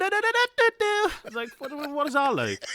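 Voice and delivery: high, loud warbled mimic; mock singer's warble. Mocking a singer's vocal runs, high and warbled. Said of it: "she does that weird m".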